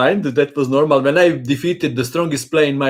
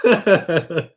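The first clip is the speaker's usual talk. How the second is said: laughing; 6 semitones higher, slower than usual. Real, not acted. Laughing at Dracula comparison.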